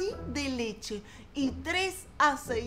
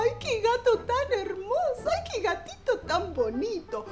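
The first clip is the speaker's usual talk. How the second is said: much higher cooing admiration; doting neighbours; 9 semitones higher than usual. A narrator's reading. Neighbours gush over the cute little cat, cooing. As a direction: coo over the sweet kitten.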